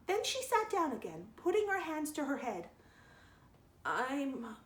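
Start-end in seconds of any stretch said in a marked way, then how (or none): 3.9-4.6 thick, very slow and heavy-tongued; thickly. A narrator's reading. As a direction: drag the words like you're tipsy. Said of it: "she said a little thickly".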